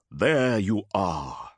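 very slow, deliberate greeting to a monster; slow menacing warrior. Acted. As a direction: savour finding the beast. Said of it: "said Motep".

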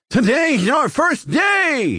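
high, slow, shouted alien cheer; excited shout. A narrator's reading. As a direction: belt it out like an overjoyed creature. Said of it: "he shouted".

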